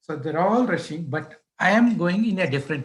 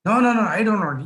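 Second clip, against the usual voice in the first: louder, higher angry refusal; mad chief sub; 5 semitones higher, 5 dB louder, much faster than usual. Retold or imitated. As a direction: act out an irate boss cutting someone off. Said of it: "he was mad that day".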